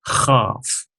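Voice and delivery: "Chaf" with a raspy kh like clearing phlegm; teacher demonstrating the guttural chaf; hoarse chaf. Shown as a demonstration. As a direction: push the start of "Chaf" through the back of the throat. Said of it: "like you're coughing up phlegm".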